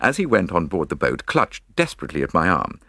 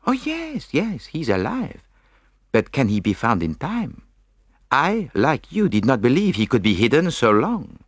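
put-on higher, musical Belgian-accented voice; accented Belgian detective; 7 semitones higher than usual. A narrator's reading. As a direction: answer as the thoughtful Belgian sleuth. Poirot answers in his accent.

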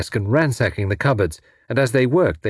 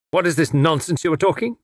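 mildly irritated retort, higher pitch; snarling; 6 semitones higher than usual. A narrator's reading. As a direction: snap back at the nonsense, understated. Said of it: "snarled the other".

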